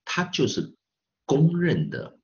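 ordinary delivery

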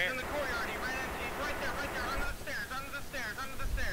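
high, urgent spotting callout; excited callout. Real, not acted. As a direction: call it out like the enemy's escaping. Pointing out the enemy urgently.

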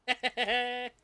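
quick staccato giggle; chuckle. Real, not acted. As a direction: laugh at your own joke. Joking about the plantation.

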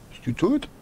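ordinary talk

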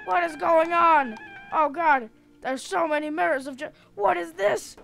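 high, loud panicked questions; hammed-up confused panic; alarmed bewilderment. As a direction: cry out, freaked out. Lost among mirrors, alarmed.

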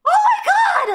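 high loud shriek of disbelief; amazed shout. Real, not acted. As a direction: yell it in real astonishment. Amazed by her train noise.

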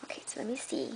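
hushed awed whisper. Real, not acted. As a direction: breathe it out as if not to disturb the paper. Peeking inside the box.

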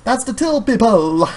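high, slow announcer's sing-song; mock announcement. Help-desk sign addressing shoppers, high announcer. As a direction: proclaim the service like a sign.

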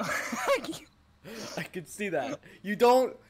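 very slow, high mock; mocking the Mario cashier. Teasing her friend's Mario cashier, high slow mock. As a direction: stretch the line out to tease.

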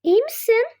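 ordinary talk

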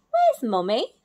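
a hint of a little owl calling; lightly voiced anxious baby; worried baby owl. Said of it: "asked Sarah".

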